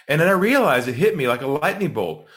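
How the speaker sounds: ordinary talk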